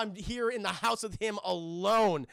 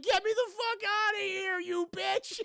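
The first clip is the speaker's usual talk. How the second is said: very high screeched demand; desperate yell; 13 semitones higher, slower than usual. Retold or imitated. Catherine in the pit yelling at the agent, desperate screech. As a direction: imitate a trapped victim screeching for help. Trapped victim yells from the pit.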